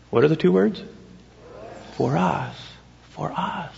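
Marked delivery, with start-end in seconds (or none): 3.1-3.7 hushed repeat. Acted. Pastor to congregation, repeating the words in a hush. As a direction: drop to a near whisper.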